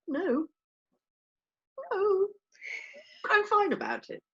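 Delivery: tiny high squeak; mock brave denial. Co-host insisting she's fine about missing her holiday, squeaky mock-brave. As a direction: squeak it out, pretending to cope.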